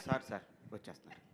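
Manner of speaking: soft, low, fast excuse; nervous hurried assistant. Retold or imitated. Imitated assistant rushes an excuse to the director, soft and fast. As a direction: gabble the excuse in a hurry. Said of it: "చెయ్యాలా వద్దా?".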